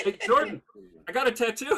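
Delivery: high, very loud excited call-out; playful shout. Pretend. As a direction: holler it to show off for a laugh. Jokingly calling out to show tattoo.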